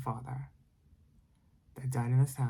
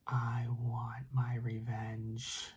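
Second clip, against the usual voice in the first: slow, eerie whispered spirit voice; hushed haunting voice, stretched out; ghostly whisper; 8 dB quieter, much slower than usual. Acted. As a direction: whisper it like a vengeful ghost, drawing out the words. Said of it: "he started hearing".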